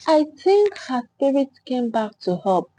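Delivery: ordinary delivery, nothing marked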